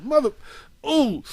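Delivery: high-pitched, bitten-back mock curse; imitated angry cussing. Son imitating dad cussing the machine out, frustrated. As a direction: swallow the swear into a high frustrated noise. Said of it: "he was cussing the machine out".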